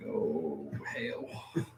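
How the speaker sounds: whispered reaction at the crest; hushed dread. Retold or imitated. Rider at the top of the hill seeing the drop, hushed dread. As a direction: drop to a near-silent, low whisper.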